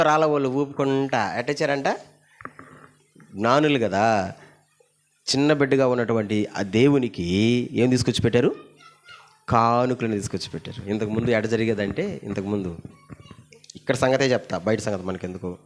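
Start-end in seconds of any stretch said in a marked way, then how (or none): none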